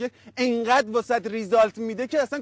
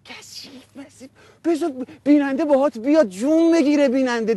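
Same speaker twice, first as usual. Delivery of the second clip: ordinary talk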